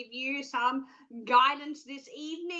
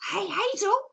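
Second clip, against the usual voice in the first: higher-pitched, cheery pretend caller asking politely; sing-song polite phone request; eager caller; 6 semitones higher than usual. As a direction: act out a caller ringing in. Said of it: "pick up that phone and go".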